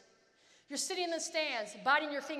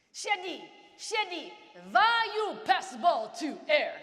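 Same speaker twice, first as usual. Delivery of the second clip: shouting and slow, mock angry coach; 3 semitones higher, 8 dB louder, slower than usual. A coach yelling at his player for a bad pass. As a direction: bark it out like a furious coach. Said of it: "coach shouting again".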